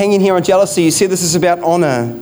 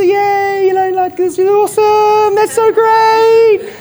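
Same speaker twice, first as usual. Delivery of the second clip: high, put-on gushing enthusiasm, slowing at the end; mock excitement in a much higher voice; fake pastor cheer; 14 semitones higher, 3 dB louder, slower than usual. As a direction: play the over-bright pastor faking delight. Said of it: "but out here I was going".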